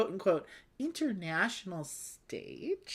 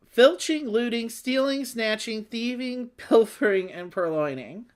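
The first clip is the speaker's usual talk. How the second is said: high, loud, savoring each pun word; relishing the puns; 6 semitones higher, 5 dB louder than usual. Real, not acted. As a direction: read the list with gleeful relish. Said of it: "I just love the puns on some of these".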